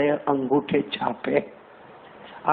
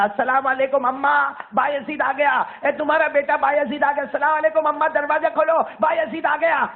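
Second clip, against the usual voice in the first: put-on boy calling out; 9 semitones higher than usual. Boy hammers on the door, calling his mother to open. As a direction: shout eagerly for mother to open up.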